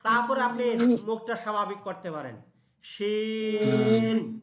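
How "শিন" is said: very slow, long শিন ending on the n with the lips open; shin, lips apart. Shown as a demonstration. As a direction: stretch শিন out and never let the lips meet. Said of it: "দুই ঠোঁট এক জায়গায় হলো না".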